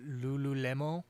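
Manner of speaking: lightly put-on French accent. Shown as a demonstration. Streamer improvising for a viewer, mock French accent. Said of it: "Do a French accent".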